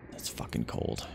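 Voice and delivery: hushed breathy grumble; soft whispered dismay; whispered curse. Real, not acted. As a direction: mutter it under your breath. Reacts to heat readout.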